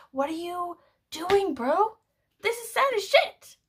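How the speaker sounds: high-pitched, incredulous outburst at a friend; can't believe they aren't crying; exasperated disbelief. Retold or imitated. Friends stone-faced at sad movie.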